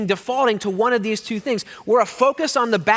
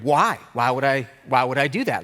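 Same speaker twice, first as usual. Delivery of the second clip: put-on suspicious teen; 7 semitones lower than usual. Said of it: "Like, so suspicious".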